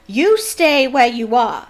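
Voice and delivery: loud, slow snarl; mother stopping her son, snarling slowly. A narrator's reading. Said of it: "snarled Missus Weasley".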